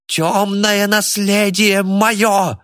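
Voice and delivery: high, slow, drawn-out wail; loud yell. A narrator's reading. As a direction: howl it as a desperate claim. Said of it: "громко завопил Кайратов".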